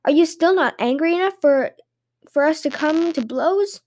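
high-pitched, pleading question; giant's plea. A narrator's reading. Giant asks shepherd to end the abuse, high-pitched plea. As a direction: ask it high, wanting it over.

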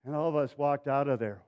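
ordinary talk, nothing marked

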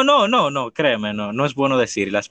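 ordinary talk

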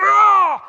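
sudden loud high cry of frustration; exasperated cry. Acted. Preacher mimics an impatient heart, loud high exasperated "Ah!". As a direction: burst out with an exasperated "Ah!".

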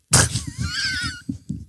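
high shrieking burst of laughter. Real, not acted. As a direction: crack up at the memory. Laughing at own story.

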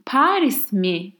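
Paris mi, slow, asked as a question; Turkish question. Shown as a demonstration. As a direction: ask Paris mi slowly. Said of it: "imagine ... I ask you".

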